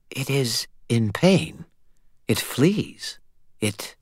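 high, slow, faraway vampire voice; distant, puzzled. A narrator's reading. Vampire sensing her hurt creature from afar, slow and high. Esmeralda senses creature in pain.

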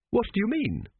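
sharp, high-pitched snap; snapped. A narrator's reading. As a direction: demand an explanation, irritated. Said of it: "snapped Tepic".

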